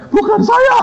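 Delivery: very high comic squeal; hammed-up terrified outburst; mock panicked denial. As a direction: yelp your innocence as a punchline. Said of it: "Saya takutnya".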